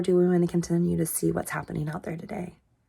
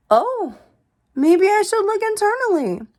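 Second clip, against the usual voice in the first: much higher, louder mock realization; sudden realization; 14 semitones higher, 6 dB louder than usual. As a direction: act out the lightbulb moment to yourself. Voicing her own realization.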